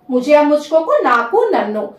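normal talking voice

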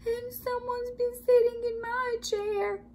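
put-on very high little Baby Bear cry; wee small voice. A narrator's reading. Baby Bear finds his chair used, high wee cry. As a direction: pipe it up high like a tiny cub. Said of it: "cried the Baby Bear in his wee small voice".

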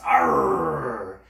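low gruff pirate growl. Pretend. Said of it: "let me see your mean pirate face".

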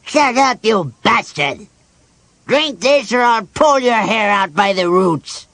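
high, loud furious shout; loud, drawn-out angry command; angry threat. Acted. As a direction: yell a threat to silence someone. Dwarf threatens the sick patient.

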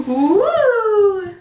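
high, loud whoop; excited cheer. Real, not acted. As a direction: cheer her on. Cheering toddler in slippers.

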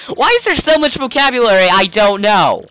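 high, fast burst of frustration; exasperated outburst. Real, not acted. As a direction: break out of character to complain. Frustrated outburst at hard words.

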